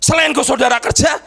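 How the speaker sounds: high, shouted preacher's exhortation; full-force preaching. Real, not acted. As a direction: rouse the congregation, peaking very high.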